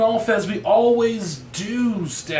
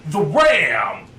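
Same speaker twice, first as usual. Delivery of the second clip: announcer voice; 4 dB louder than usual. Acted. Announcing award title.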